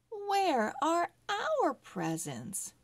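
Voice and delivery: lightly voiced kid wanting his presents; eager little boy. A narrator's reading. Boy Harry asks in story.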